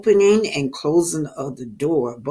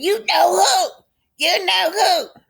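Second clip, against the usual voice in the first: insistent retort; 13 semitones higher, 3 dB louder than usual. Acted. Tisha refusing to name her, high and insistent. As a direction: throw it back high and pointed.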